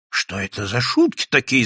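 usual speaking voice

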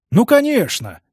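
high-pitched, stretched-out agreement; drawn-out assurance. A narrator's reading. Pollunder fibbing to reassure Karl, drawling. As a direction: draw it out, not quite convincingly. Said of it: "протянул господин Поландер".

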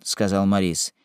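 ordinary delivery

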